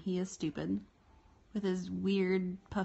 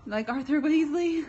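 amused surprise; 6 semitones higher, 8 dB louder than usual. Real, not acted.

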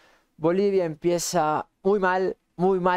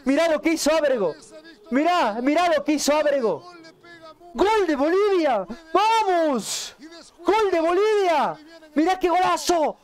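breakneck goal commentary; 6 semitones higher than usual. Real, not acted. Celebrating Bolivia's goal.

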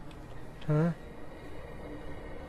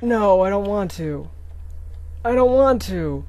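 louder, higher, slow whining plea; frightened whine; 4 semitones higher, 6 dB louder than usual. Real, not acted. Scared gamer forced back into danger, whining plea. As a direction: beg not to go back. Terrified pleading.

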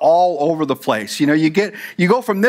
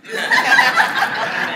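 short laugh. Real, not acted. Laughs at his own freeway example.

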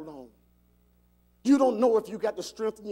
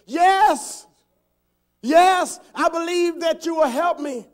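high, loud, desperate acted yes; eager hollered answer from a man in peril; yelled plea; 10 semitones higher, 12 dB louder, slower than usual. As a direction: shout back up to heaven. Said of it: "then he yells out".

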